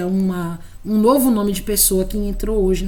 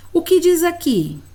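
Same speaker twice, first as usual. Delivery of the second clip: pergunta de professora; 9 semitones higher, 6 dB louder than usual. Shown as a demonstration. Professora demonstra pergunta à criança.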